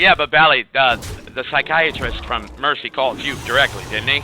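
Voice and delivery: loud, insistent retold plea; pressing the pharmacist. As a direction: raise the voice to push the point. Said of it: "I'm like".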